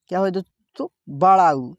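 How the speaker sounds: ordinary talk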